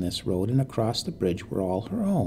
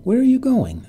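much higher and louder, rising alarm; anxious, raised question to silent strangers; 12 semitones higher, 6 dB louder than usual. Acted. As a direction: push the question up, anxious and loud. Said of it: "her voice rising".

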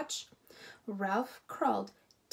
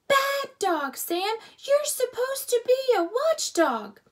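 put-on much higher, loud child's scolding yell; scolding shout; 13 semitones higher, 10 dB louder than usual. A narrator's reading. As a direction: scold the dog while bouncing. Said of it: "began to scold Sam".

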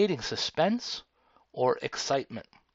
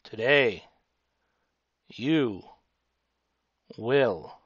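slow halting delivery; 4 semitones lower, much slower than usual. Shown as a demonstration. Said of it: "the second way".

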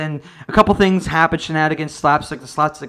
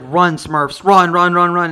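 louder urgent calling; Papa Smurf urging them to flee; mock heroic shout; 7 dB louder, slower than usual. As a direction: yell it like a sacrificing hero. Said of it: "he's like".

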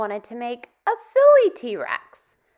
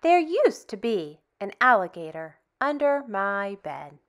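put-on reading to children; 4 dB louder than usual. Teacher reading a picture book to kids, lively and expressive. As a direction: tell it like a bedtime story to little ones.

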